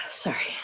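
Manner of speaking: weary sigh. Real, not acted. Narrator breaks off.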